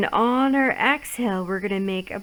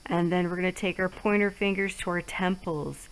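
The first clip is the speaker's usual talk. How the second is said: guided relaxation; 2 semitones lower, 4 dB quieter than usual. Acted. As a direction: ease them through each stroke softly. Leads guided face massage.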